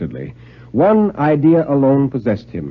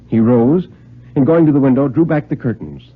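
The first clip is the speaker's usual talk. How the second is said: plain reading voice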